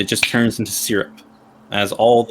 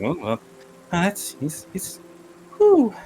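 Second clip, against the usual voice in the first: horse lost for words; stunned horse; 7 semitones higher than usual. Acted.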